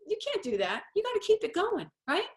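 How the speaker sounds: ordinary talk, nothing marked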